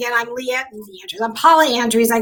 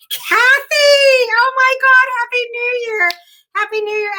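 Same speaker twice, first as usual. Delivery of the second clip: much higher, louder, near-tearful cry of joy; overjoyed squeal; 11 semitones higher, 3 dB louder than usual. Real, not acted. Host spots old friend Kathy in the chat, overjoyed squeal. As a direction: squeal her name like a long-lost friend.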